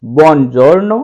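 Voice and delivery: buonasera drawn out syllable by syllable; repeat-after-me drill; slow model word. Shown as a demonstration. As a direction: stretch buonasera slowly, over and over. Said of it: "साथ में बोल करके repeat कीजिए".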